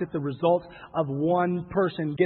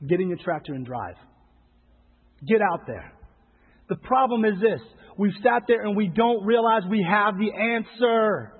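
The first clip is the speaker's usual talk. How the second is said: ordinary talk, nothing marked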